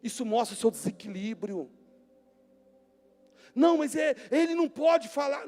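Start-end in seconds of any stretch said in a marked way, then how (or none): 3.5-5.5 mock indignant protest. Mocking an indignant person.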